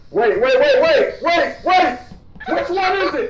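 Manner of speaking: high, loud rising panic; frantic. Real, not acted. Frantic about killer color.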